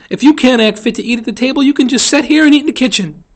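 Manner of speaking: loud, high-pitched telling-off; Calpurnia, scolding fiercely. Acted. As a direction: give a child a fierce dressing-down.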